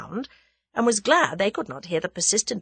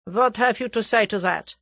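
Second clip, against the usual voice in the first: put-on German-accented interrogator; 4 semitones higher than usual. A narrator's reading. German questions Tommy, German accent. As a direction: demand an answer in German accent. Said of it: "said the German".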